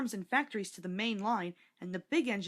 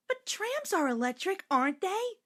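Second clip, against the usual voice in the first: put-on much higher girl's questioning voice; little girl insisting; 11 semitones higher, 4 dB louder than usual. A narrator's reading. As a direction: insist like a stubborn small girl. Bridget insists.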